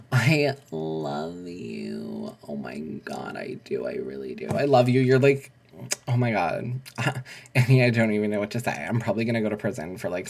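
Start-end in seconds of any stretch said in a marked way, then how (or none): 0.1-4.5 very slow, lower, lingering; drawn-out tender. Acted. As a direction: stretch it out like a crooner. Repeats love declaration.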